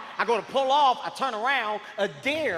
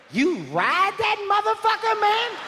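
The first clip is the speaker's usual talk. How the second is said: very high, held-up comic squeal; high-pitched exclamation; 11 semitones higher, slower than usual. Acted. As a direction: shoot way up high and stay there for the punchline. Exasperated punchline reply to the man.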